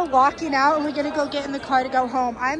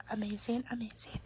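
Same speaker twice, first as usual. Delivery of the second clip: breathy hushed whisper, much softer; 3 semitones lower, 14 dB quieter than usual. Real, not acted. Vlogger showing merch whispers in awe. Admiring the shirt quietly.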